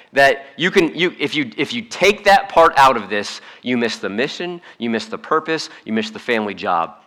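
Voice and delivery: ordinary talk, nothing marked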